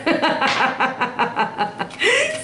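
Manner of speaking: high cackling laugh. Real, not acted. Reader laughs after her advice on the kids, cackling. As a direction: burst into a long, rippling laugh.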